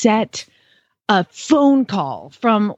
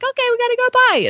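high cartoon sign-off; pitched-up imitation of a cheery caller hanging up; SpongeBob; 13 semitones higher, faster than usual. Retelling how the cartoon caller ends the phone call, high imitated goodbye. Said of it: "And they're like".

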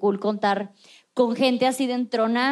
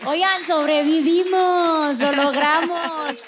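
relieved triumph; 5 semitones higher, 3 dB louder, slower than usual. Real, not acted. Host ending podcast episode.

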